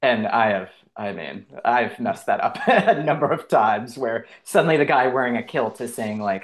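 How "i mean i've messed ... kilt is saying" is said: ordinary talk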